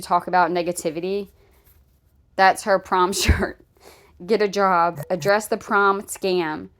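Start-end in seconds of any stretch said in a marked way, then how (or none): none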